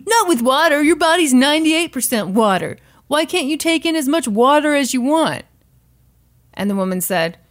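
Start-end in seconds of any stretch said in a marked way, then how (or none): 0.1-5.4 high, loud confident DJ; radio DJ. Retold or imitated. Guy DJ brushing off water danger, high loud imitation. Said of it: "the guy D J said".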